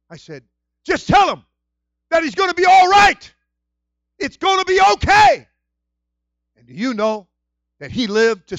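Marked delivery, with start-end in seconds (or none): 0.8-5.5 loud, high urging; earnest reassurance. Retold or imitated. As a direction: press the comfort on her for the pastor. Comforting message to sick pastor.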